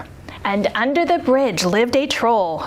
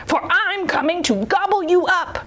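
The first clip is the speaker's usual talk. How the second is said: higher, lightly voiced storybook troll; menacing troll; 8 semitones higher than usual. A narrator's reading. Troll threatens to eat the little goat, mildly menacing. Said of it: "said the troll".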